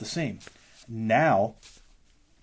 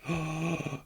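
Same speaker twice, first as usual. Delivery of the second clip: sharp excited gasp; mock gasp; 5 semitones higher, 6 dB quieter than usual. Imitating a stunned winner with a gasp. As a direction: gasp like you just won. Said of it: "For you to be like".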